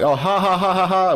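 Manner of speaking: high forced mock laugh; squeaky hammed-up laughter; fake laugh. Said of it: "She'd be like".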